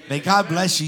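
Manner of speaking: loud call-out. Real, not acted. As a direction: call it out big so they answer. Congregation call-and-response cue.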